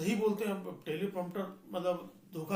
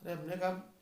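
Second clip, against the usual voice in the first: ordinary delivery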